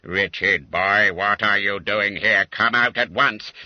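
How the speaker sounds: loud, stern command; stern order. Acted. Master commanding the hiding boy out, stern and loud.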